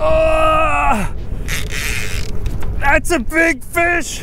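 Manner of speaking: high, loud, awestruck exclamations; stretched-out, high-pitched thrilled yelling; real excitement. Real, not acted. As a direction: cry out in amazement at a huge catch. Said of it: "I'm a little excited right now".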